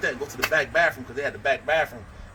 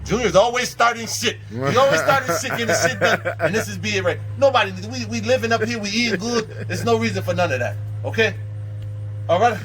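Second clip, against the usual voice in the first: higher-pitched imitation of a fed-up peacemaker; exasperated peacemaker; 7 semitones higher than usual. Man telling Bobby that Junior starts trouble, mock exasperated. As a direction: lay down the law, pitched up. Acting out man settling the quarrel.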